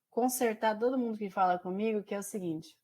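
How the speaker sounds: usual speaking voice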